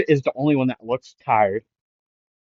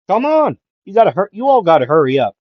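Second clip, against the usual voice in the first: urgent call; 6 semitones higher, 5 dB louder, faster than usual. Retold or imitated. Midoriya calling to others.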